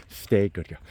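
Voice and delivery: usual speaking voice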